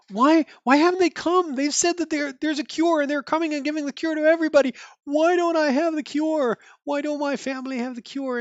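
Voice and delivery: put-on high, pleading young boy voice. Boy at the window asking why no cure came, high pleading kid voice.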